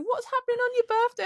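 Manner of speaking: imitating a perky well-wisher, very high; cheery inquiry. Coworker asking about birthday plans mid-argument, high cheery imitation. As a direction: chirp it in a mock high voice.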